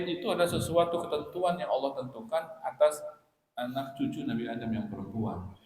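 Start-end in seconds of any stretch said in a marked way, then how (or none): none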